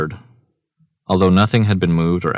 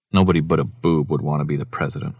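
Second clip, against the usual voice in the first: bitter. Acted. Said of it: "Ralph said bitterly".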